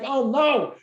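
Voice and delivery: mock dismay. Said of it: "I'm like".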